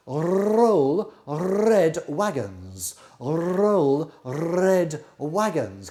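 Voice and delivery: very slow, each R rolled deliberately; slow over-articulated. Shown as a demonstration. Flute teacher modelling a tongue twister, slow and deliberate. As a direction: spell it out slowly so a student can copy. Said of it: "Can you say that?".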